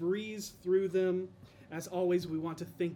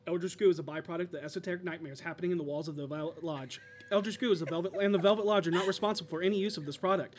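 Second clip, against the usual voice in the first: fast ad disclaimer; faster than usual. Pretend. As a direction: rush through it like a drug ad disclaimer.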